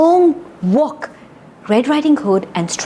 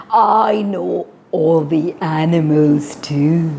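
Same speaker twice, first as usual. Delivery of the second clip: slow, lightly lowered sly wolf voice; a hint of a sneaky wolf; big bad wolf; 2 semitones lower, slower than usual. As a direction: coax her like a sly wolf, drawing it out.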